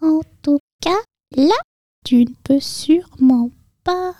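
minute, high mouse squeak; teeny halting mouse voice; tiniest voice. A narrator's reading. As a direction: break it into tiny high peeps. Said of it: "prit sa plus petite voix".

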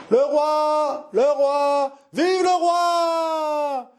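high, long-held hailing call; crowd cries. Acted. Courtyard hailing the king's arrival, crowd cries. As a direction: cry it out like a herald across a courtyard. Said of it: "Des cris éclataient dans la cour".

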